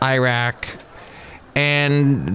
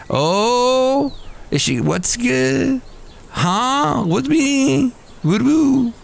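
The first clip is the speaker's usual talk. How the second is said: high, drawn-out oohs; exaggerated high-pitched gasping onlookers; mock scandalized reaction; 12 semitones higher, much slower than usual. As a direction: imitate a crowd wondering what's coming, way up high. Acts out startled concert crowd reaction.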